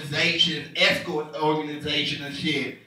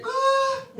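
high hesitant uh. Retold or imitated. Son stalling to dad again.

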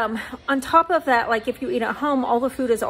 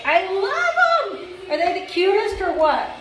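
high, slow, drawn-out gush; 7 semitones higher than usual. Real, not acted. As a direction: swoon over the overalls. Gushing about her overalls.

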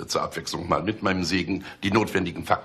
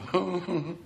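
amused laugh; 11 semitones higher, 4 dB quieter than usual. Acted. Boss mocking "telefoniert rum.

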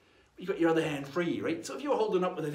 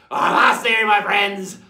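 very loud, hearty call; pirate hail; 2 semitones higher, 15 dB louder, slower than usual. Acted. Pirate signs off to his friends, booming hail. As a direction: bellow a farewell like a pirate. Pirate hails audience in farewell.

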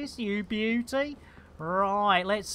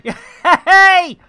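laughing hey; 10 semitones higher, 13 dB louder than usual. Real, not acted. Laughing greeting.

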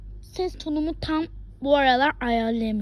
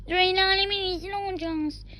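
put-on voice; 5 semitones higher than usual. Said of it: "ses taklidinde göstereceğim".

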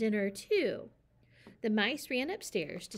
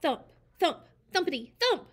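loud, pitched-up thump sounds; 7 semitones higher, 7 dB louder than usual. A narrator's reading. Narrator booms the noise from upstairs. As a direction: bang out the thumps.

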